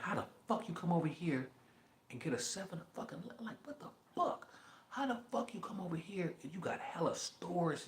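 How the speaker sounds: high, bewildered mock voice; mock bewildered neighbor. Mocking locals who resent corner store owners, high and baffled. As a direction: ham up a baffled neighbor asking.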